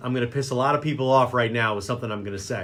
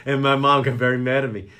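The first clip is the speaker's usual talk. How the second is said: ordinary talk, nothing marked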